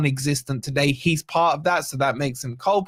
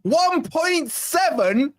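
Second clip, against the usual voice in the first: much higher, slow disbelief; incredulous; 10 semitones higher, much slower than usual. Real, not acted. Streamer reacts to the viewers' rating, high and incredulous. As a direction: stretch it out in disbelief.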